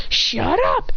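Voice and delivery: high-pitched fed-up mock shout; acted yell. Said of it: "Then I yell".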